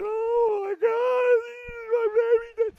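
high, loud, slow hammed-up wail; mock wailing mother. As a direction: wail for the dead baby, overdone. Bathsheba's grief voiced.

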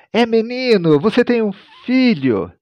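high, joyful birth news; dramatized high cry of a news-bearer; excited announcement. A narrator's reading. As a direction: proclaim the good news with pitch soaring.

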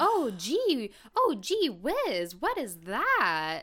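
high, slow gee-whiz voice; hammed-up fifties detective gasp; old-timey detective. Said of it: "and they're like".

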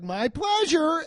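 very high, drawn-out customer-service voice; mock service cheer. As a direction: sing out the stock phrase slowly and high. Parodies fast-food worker's stock phrase.

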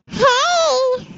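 high little mouse voice effect; mousy voice. Acted. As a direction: squeak it like a mouse. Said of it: "High-pitched little mousy mouse voice".

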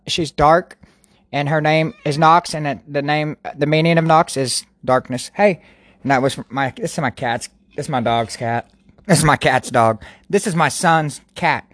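usual speaking voice

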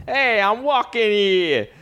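hammed-up American street accent, stretched out; mock American accent. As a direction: do a caricatured American accent, pitched high. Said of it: "considering you got people going".

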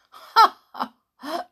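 loud mocking ha ha; gloating villain laugh. Headmaster gloating over burnt letters, loud laugh. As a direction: cackle in triumph.

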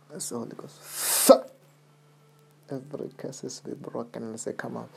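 soft, fervent prayer; murmured prayer. Real, not acted. Pastor prays over caller.

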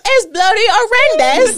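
loud, high hammed-up British; mock British accent. Showing off her only British line, laughing. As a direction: overdo a posh British complaint. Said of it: "that's all I got".

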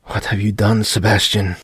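ordinary delivery, nothing marked

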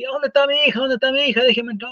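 high, pleading father demanding to see his daughter; frantic father. Retold or imitated. A father at the hospital desperately demands to see his daughter, high and pleading. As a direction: push past the nurse, desperate.

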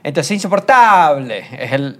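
slow, "insoportable" with a long "ta"; stressed vowel stretched. Shown as a demonstration. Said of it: "cuando la vocal tónica".

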